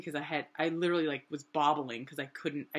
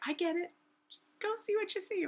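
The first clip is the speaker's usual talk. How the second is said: high-pitched friend imitation; Lonnie. Friend answering her complaint, imitated high voice. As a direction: placate in a pitched-up voice. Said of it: "And she was like".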